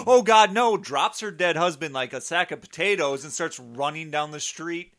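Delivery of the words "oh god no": loud, high cry of alarm; hammed-up horrified outburst; mock panic. Mother seeing her children driven off, mock panicked cry.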